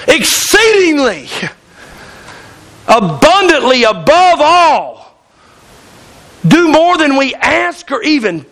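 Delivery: slow, drawn-out reading of the verse; preacher reading a verse aloud to the congregation; scripture reading. Acted. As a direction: read it slowly, weighing each phrase. Reads scripture verse slowly.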